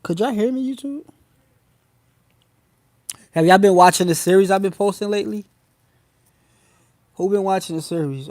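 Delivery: loud call to the viewers. Real, not acted. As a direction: raise the voice to reach the chat. Calling out to stream viewers.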